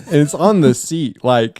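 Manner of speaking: normal talking voice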